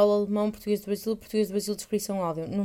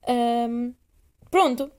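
normal talking voice

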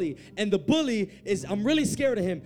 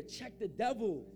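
slow, softer, measured command; restrained instruction; 11 dB quieter, slower than usual. Real, not acted. Preacher telling congregation what to say, restrained. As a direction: say it slowly, held back.

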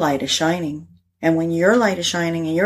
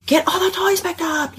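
put-on much higher, sing-song nagging call to the kids; mock mom scolding; 12 semitones higher than usual. Mom scolding kids about toys.

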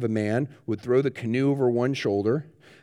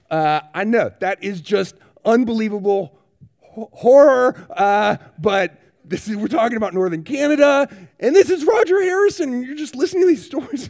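higher and louder, swept up in wonder; can't believe what you're telling them; rising astonished preaching; amazed disbelief; 9 semitones higher, 7 dB louder than usual. Real, not acted. Marvelling at Roger's wild stories.

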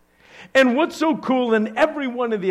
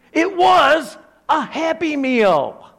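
mock insistence; 9 semitones higher, 6 dB louder, slower than usual. Insisting loudly after lighten up.

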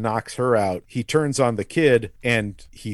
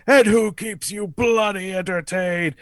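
high, theatrical imitation of a ranting villain; rallying villain; 7 semitones higher than usual. Villain in monologue to his crowd, high rallying call. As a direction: build the question up for the crowd.

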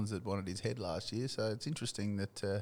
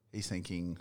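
normal talking voice

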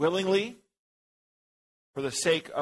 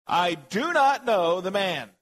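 louder, higher, slow emphatic oath; sworn denial; 4 semitones higher, 5 dB louder, slower than usual. A narrator's reading. Peter denying Jesus to the bystanders, an emphatic oath. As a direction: swear it forcefully, drawing out each word. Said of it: "with an oath".

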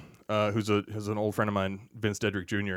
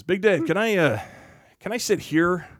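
giggly request; 8 semitones higher than usual. Retold or imitated. Jeff asks giggling.

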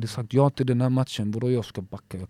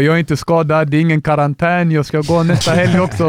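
raised, louder retold protest to the referee; retold insistence; 5 semitones higher, 8 dB louder than usual. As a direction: replay the insisting, a notch up. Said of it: "Och sa, jag bara".

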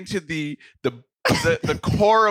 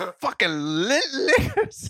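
mock cursing; 7 semitones higher, slower than usual. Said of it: "He was, he was like".

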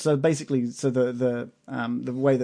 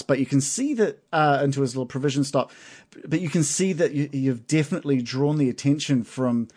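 ordinary delivery

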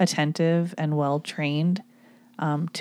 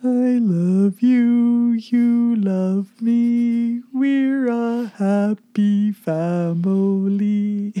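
slow, higher sing-song purple dinosaur voice; Barney the dinosaur; 4 semitones higher, 3 dB louder, much slower than usual. Retold or imitated. Asked by her partner, she croons a slow dinosaur voice. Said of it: "give me your best Barney the dinosaur".

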